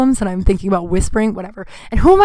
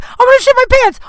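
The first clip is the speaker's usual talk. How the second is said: high, loud panicked yell; 6 dB louder than usual. Retold or imitated. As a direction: shout "I'm gonna shit my pants" in a desperate inner panic. Inner panic, needs the bathroom.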